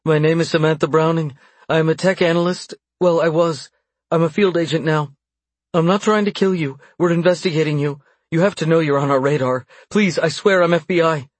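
high, urgent, pleading confession; fearful babbling. A narrator's reading. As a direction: spill the truth in fear. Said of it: "She was babbling, fear making the truth spill".

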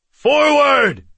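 high, loud battle cry; calling out. Acted. Amulon calls his Taurens to charge, shouting. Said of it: "Amulon called".

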